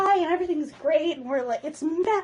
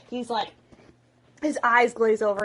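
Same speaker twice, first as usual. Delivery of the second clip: usual speaking voice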